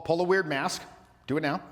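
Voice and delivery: ordinary talk, nothing marked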